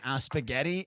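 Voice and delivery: low, soft, hesitant "Uh, spaghetti"; meek answer. Retold or imitated. Retelling own pasta order to a friend, meek answer. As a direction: offer spaghetti quietly and sheepishly. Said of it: "I went".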